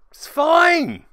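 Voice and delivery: squeaky high mock-cheerful cry; strained it's fine. Player fakes calm as his model is healed, then cracks. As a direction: voice cracks upward as the calm gives way. Sarcastic breaking point.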